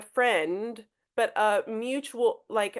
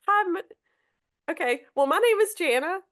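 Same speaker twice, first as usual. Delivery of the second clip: higher, fumbling for words; stumbling greeting; 8 semitones higher than usual. Shown as a demonstration. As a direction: get stumped mid-hello. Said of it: "I might just go".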